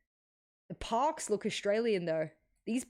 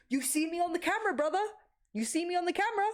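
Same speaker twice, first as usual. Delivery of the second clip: hyped up; 9 semitones higher, faster than usual. Real, not acted. Hyping himself to chat.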